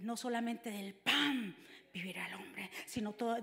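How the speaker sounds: Jesús respondiendo en susurro; déjalo caer casi sin voz, susurrando; susurrado. Acted. (Jesús le contesta al diablo en un susurro)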